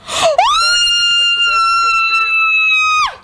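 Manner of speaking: long, very high-pitched shriek of shock; gasp, then let out a long squealing scream. Real, not acted. Player seeing the challenge won, shocked shriek.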